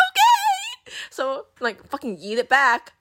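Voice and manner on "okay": extremely high squealed okay; comic sobbing squeak; mock tearful wail. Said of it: "still crying at this point".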